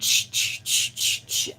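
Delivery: repeated voiceless "shh" swipes; short bursts of hushing noise for a furious swipe; imitated wipe ripping. Creator showing how they took the wipe, imitated noise. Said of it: "I took the makeup wipe ... and went".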